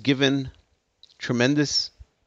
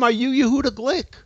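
much higher, strange put-on voice; imitating the gunman; 12 semitones higher than usual. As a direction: squeak out the gunman's question. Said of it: "quote unquote".

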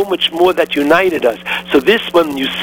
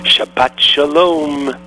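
slow solemn close; 5 semitones lower, slower than usual. Real, not acted. Closing blessing slowly.

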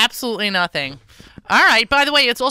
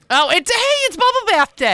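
high excited; 11 semitones higher, 3 dB louder than usual. Real, not acted. Excited joke.

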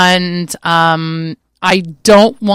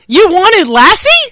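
incredulous; 9 semitones higher, 7 dB louder, much slower than usual. Real, not acted. Incredulous at host's dog pick.

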